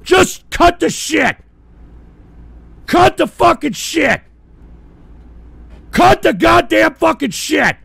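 high, loud, slow enraged repeats; building fury; furious building yell. Real, not acted. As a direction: hammer each word. Streamer yelling at doxing TTS.

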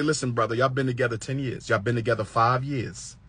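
soft, low talk to a brother; hushed, reasoning appeal; gentle counsel. Acted. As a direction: quietly talk a friend down. Acted counsel to a friend.

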